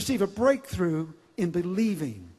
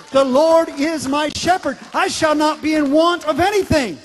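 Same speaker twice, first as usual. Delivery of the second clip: loud scripture recitation; 5 semitones higher, 7 dB louder than usual. Shown as a demonstration. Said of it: "I like saying it like this".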